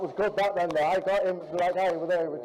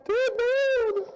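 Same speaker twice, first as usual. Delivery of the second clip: high praise; 5 dB louder than usual. Real, not acted. Praising teammate again.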